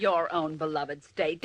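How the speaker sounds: slow, low, scornful repeat; low drawn-out mocking sneer; sarcastic echo. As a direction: throw his words back at him with contempt. Reporter mocks villain's words.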